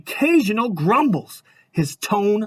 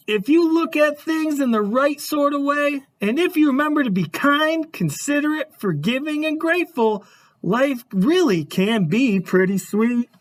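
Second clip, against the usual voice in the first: storybook narration; 6 semitones higher than usual. A narrator's reading. Reads moral of the story.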